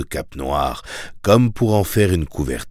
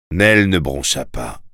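ordinary delivery

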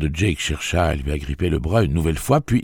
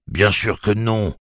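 growled; 6 semitones higher, 4 dB louder, slower than usual. Acted. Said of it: "gronda Elmer Chambers".

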